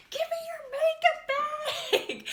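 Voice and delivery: high, slow, loud demand through laughter; laughing mock demand. Demanding the makeup bag from the roommate, laughing and high. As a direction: demand it while cracking up.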